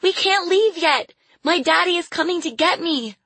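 put-on loud, high-pitched desperate child; screaming. A narrator's reading. Caged girl begs the departing train to wait, screaming. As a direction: shriek in panic like a little girl. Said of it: "she screamed".

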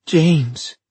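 high, loud anguished cry; desperate. Acted. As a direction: grieve for her lost child. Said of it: "she thought desperately".

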